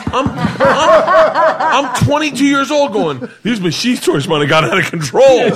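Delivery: high, loud and laughing through it; cracking up at the memory. Real, not acted. Telling friends the train story, laughing high and loud. Laughing while retelling the scene.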